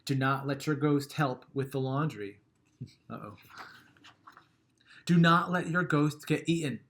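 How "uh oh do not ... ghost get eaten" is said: ordinary delivery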